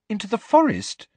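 high, puzzled question; can't believe where they're going; perplexed. Acted.

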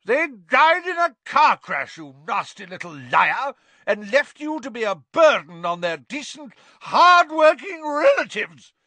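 very high, loud, furious scream; enraged aunt screaming; screaming Aunt Marge. A narrator's reading. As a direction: shriek back at him with fury. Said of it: "screamed Aunt Marge".

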